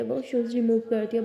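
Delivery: usual speaking voice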